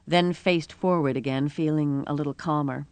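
usual speaking voice

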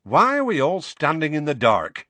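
a bright stranger asking about the dark; young woman's voice. Acted. A witch in the hall asking why it's dark, puzzled. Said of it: "said a third voice ... a woman's".

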